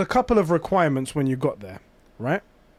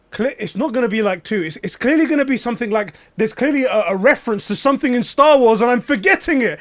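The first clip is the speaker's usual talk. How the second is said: higher-pitched, tumbling, stumbling worry; retold panic; 6 semitones higher, 4 dB louder than usual. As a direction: spiral into overthinking, voice climbing. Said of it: "two minutes of me panicking".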